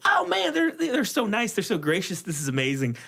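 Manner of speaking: high, gushing delight; mock-thrilled gratitude. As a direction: gush over the kind gift like a fan. Retold gushing about gift.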